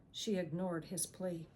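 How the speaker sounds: ordinary delivery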